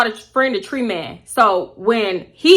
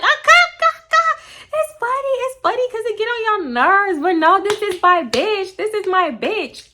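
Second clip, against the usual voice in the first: mock cackling friend; 10 semitones higher than usual. Friend laughing off the ninth-grade fight, mocking high voice.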